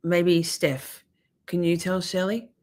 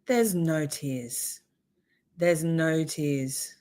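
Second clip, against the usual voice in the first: slow mocking drawl; 3 dB quieter, much slower than usual. Real, not acted. Mocking witness fake crying.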